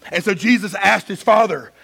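ordinary delivery

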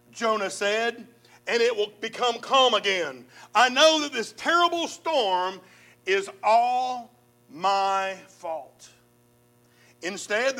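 measured, dramatic Bible reading; preacher reading scripture with rising drama. Acted. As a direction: read the story to the congregation. Reads Jonah's story aloud.

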